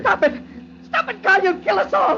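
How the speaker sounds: high, frantic yelling; panicked shout. Acted. Man in speeding car begging the ghost, panicked. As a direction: scream at him to stop. Husband begs ghost driving fast.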